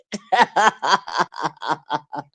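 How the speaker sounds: long amused laugh; laughter. Real, not acted. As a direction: let a laugh roll out. Laughs after Lebanon joke.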